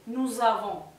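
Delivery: "Nous avons" said as nuz-avons; liaison z. Shown as a demonstration. Teacher repeating the liaison: nuz avons. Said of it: "como una Z".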